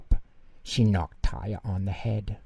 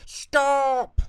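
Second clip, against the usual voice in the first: very high, loud cry of stop; loud yell; 6 dB louder than usual. Acted. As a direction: yell it to freeze him. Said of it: "Taya yelled as loudly as she could".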